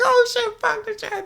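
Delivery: very high mock crowd voice; imitated crowd reaction. Audience reacting to the comic's club joke, high mock voice.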